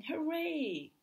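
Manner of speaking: high mock cheer. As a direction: cheer like happy villagers. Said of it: "villagers shouting".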